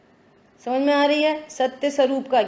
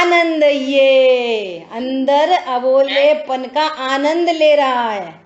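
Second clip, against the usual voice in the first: drawn-out and louder, savouring each word; slow emphatic repeat; 4 dB louder, much slower than usual. Shown as a demonstration. Preacher to his listeners, repeating slowly and emphatically. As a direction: stretch it out so it sinks in.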